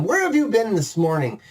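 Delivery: scolding wife. Acted. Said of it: "Una lays into him".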